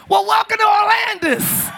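high, loud, drawn-out joking welcome; shouted welcome. Real, not acted. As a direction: belt out a welcome to the crowd. Loud mock welcome to newcomer.

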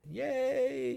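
high, drawn-out yay; high-pitched hooray; celebratory cheer. Real, not acted. Cheering shoutout to new listeners.

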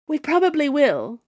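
high, loud panted reply; panting. Acted. Ziyi answering the Snow Queen, panting. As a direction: pant it out mid-fight. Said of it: "Ziyi panted".

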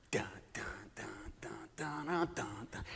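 soft whispered dun dun spy theme; whispered suspense music. Acted. Imitating ninja movie music, whispered and very soft. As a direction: breathe the sneaky movie beat.

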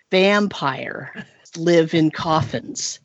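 very slow, drawn-out; deliberate, dramatic slow reveal. Retold or imitated. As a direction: spell it out word by word for the kid. GM revealing the answer.

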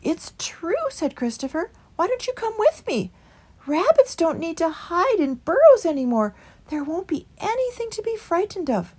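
meek small creature pleading for the way; Christopher. A narrator's reading. Christopher persuades.

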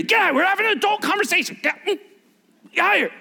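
high, fast, annoyed shooing voice; mock-irritated adult telling kids to get out; disciples shooing kids. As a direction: shoo the kids out like an irritated grown-up. Disciples shooing children away.

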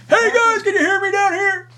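distant shout through the floor; yelling from downstairs. Real, not acted. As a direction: call up from the floor below, laughing. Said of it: "I'm trying to yell up to the microphone".

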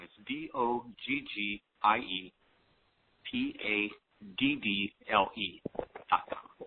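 letters spoken one by one; D O G G I E, each letter separate; spelling it out. Shown as a demonstration. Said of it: "check out my video".

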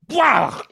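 big loud mock sneeze, high; dad sneeze. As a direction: blast out a fake sneeze. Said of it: "it's straight up".

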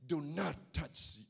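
low, slow, commanding voice from above; slow and deep, forbid the act; voice of God. Acted. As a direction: heavenly voice stopping Abraham. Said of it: "and the voice said".

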